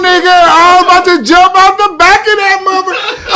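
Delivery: half-shouting in mock panic; panicked yell. Real, not acted. Telling friends about the wrong hospital, panicked yelling.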